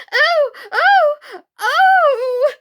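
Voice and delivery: very high, loud shrieking oh; steam-whistle scream. Acted. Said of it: "like the whistle of a steam engine".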